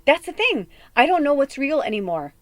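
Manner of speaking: ordinary talk, nothing marked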